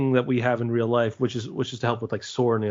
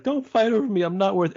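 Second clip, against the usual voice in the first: Angeline's plea; 8 semitones higher than usual. Retold or imitated. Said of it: "she's like".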